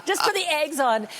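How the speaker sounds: high, loud mock protest; hammed-up pleading, breaking into a laugh; exasperated kids. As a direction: imitate children yelling at their mother. Imitating kids begging her to stop.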